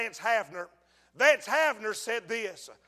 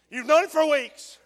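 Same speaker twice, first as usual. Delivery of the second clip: louder and higher; pressing it louder; 6 semitones higher, 9 dB louder than usual. Real, not acted. Preacher repeats it louder to press the sinner. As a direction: push the point home.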